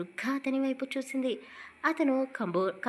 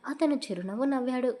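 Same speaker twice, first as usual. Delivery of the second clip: ordinary delivery, nothing marked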